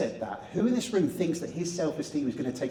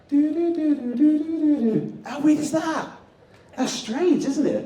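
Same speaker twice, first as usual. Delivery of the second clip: much higher, louder eerie "do, do, do" and wonder; spooky mock tune; 13 semitones higher, 7 dB louder than usual. Speaker teases the audience after the hand trick, eerie high singsong. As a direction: ham up a spooky mystery jingle.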